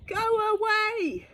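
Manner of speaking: high, loud, drawn-out shout; shouting at the river. Acted. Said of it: "he shouts at the river".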